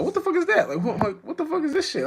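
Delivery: high, laughing mock outrage; giggling imitation of someone baffled; laughing disbelief. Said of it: "But they be like".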